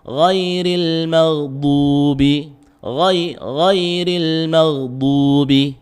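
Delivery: very slow chanted "Ghairil maghdubi" with heavy "gh" and "d"; dark, throaty "gh" and emphatic "du" in "maghdubi"; thick ghain and dhad. Shown as a demonstration. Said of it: "gitu, tebal".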